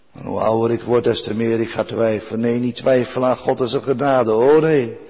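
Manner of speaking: ordinary delivery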